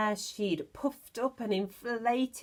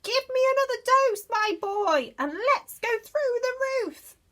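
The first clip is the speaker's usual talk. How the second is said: put-on screechy old woman; 5 dB louder than usual. Grandma demanding more from attic.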